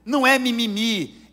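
rising fervent preacher, loud and high; preaching at full force. Real, not acted. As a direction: drive home that it is sin. Preaching climax. (pregação inflamada, alta e aguda)

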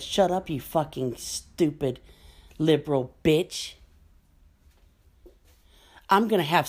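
imitating angry patriot. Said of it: "He said".

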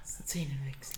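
whispered repeat. Shown as a demonstration. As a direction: breathe the word, no voice. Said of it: "sprich mal nach".

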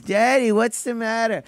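put-on high, small child's voice; little kid voice. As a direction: play yourself as a scared little boy. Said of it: "dumb little [name]".

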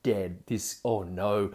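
a soft, slowed-down oh no of doom; hushed mock panic, dragging the oh no; quiet dread. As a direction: mutter it like you know you're finished. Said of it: "I'm like".

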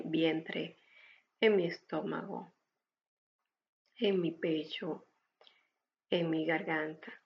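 usual speaking voice

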